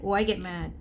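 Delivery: ordinary talk, nothing marked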